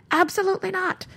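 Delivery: high-pitched mock refusal of a protesting body; emphatic refusal. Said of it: "my body goes".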